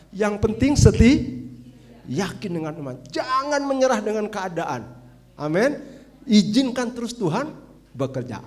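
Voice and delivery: loud, ringing call to faith; preacher rallying the congregation loudly; preaching climax. Acted. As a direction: shout the exhortation to the church. Closing exhortation shouted.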